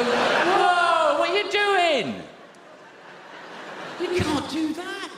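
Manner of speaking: high, loud, slow mock protest; hammed-up high alarmed shout; alarmed outcry. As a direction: cry out high in shocked alarm. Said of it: "We go".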